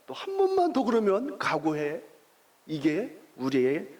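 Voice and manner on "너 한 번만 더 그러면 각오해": higher, stern threat; warning dad. Retold or imitated. Father warning his son one last time, higher and stern. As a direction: warn him, pitch raised. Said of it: "또 무슨 말 하죠?".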